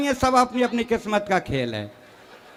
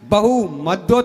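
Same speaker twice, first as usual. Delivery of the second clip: imitating Sonia Gandhi; 4 semitones lower, much slower than usual. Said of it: "जब जब सोनिया जी भाषण करती हैं".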